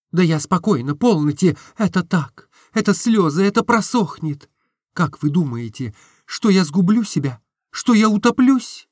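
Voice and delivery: put-on girl insisting through tears; Nastenka tearful. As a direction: claim calm through tears.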